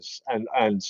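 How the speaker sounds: normal talking voice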